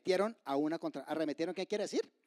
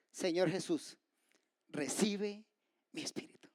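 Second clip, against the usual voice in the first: prayer fading to a whisper. Acted. Preacher voices Stephen praying as he dies, fading invocation. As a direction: let the plea fade into breath. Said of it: "Mientras él invocaba y decía".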